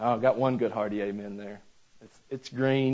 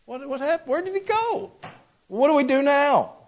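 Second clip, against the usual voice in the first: bewildered cry; 13 semitones higher than usual. Acted. Disciples bewildered after Jesus ascends.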